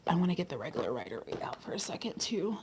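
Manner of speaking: quick, soft aside to yourself; whispered aside. Real, not acted. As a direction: whisper it fast under your breath. Muttered aside while fetching deck.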